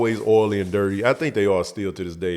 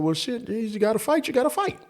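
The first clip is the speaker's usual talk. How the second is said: dad pushing; 13 semitones higher than usual. Retold or imitated. Dad insisting, pitch climbs.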